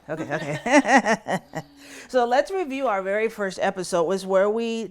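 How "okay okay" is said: louder, slow laugh through held-back tears; tearful laugh. Real, not acted. Reverend to listeners after sharing loss, laughing through tears.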